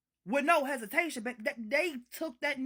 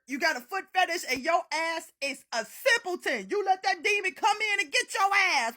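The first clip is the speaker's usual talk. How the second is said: normal talking voice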